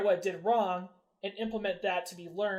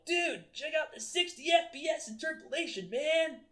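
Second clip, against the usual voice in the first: excited Reddit bro; 8 semitones higher than usual. Retold or imitated. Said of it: "people are like".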